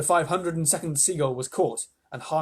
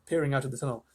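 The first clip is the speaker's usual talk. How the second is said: ordinary delivery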